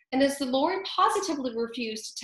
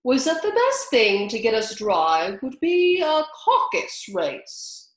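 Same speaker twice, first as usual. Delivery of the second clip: slow, stiffly offended; 6 dB louder than usual. A narrator's reading. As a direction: let the hurt pride show. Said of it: "said the Dodo in an offended tone".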